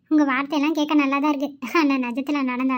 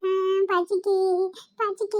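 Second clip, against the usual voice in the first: put-on higher, whiny little-boy voice fading off; hungry child; 5 semitones higher, 4 dB quieter, much slower than usual. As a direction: whimper it like a small hungry kid.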